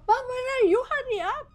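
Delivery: high, loud panicked where are you; panicked plea. Retold or imitated. Daughter on the phone begging mother to hurry, panicked. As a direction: plead frantically for help to come.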